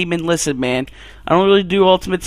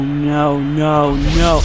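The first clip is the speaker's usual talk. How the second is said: normal talking voice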